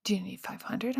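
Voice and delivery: hushed question. Retold or imitated. Printer question, whispered.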